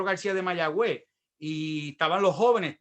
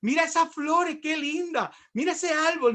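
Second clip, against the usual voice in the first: high-pitched weepy wonder; mock his younger self crying over creation; imitated tearful gushing; 8 semitones higher than usual. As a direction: gush in a high, whiny sob-voice. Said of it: "¿Por qué tú estás llorando tanto?".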